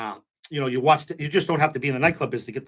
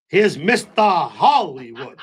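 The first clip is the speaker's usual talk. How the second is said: mock announcer intro; 3 semitones higher, 5 dB louder, much slower than usual. Friend announcing him on street.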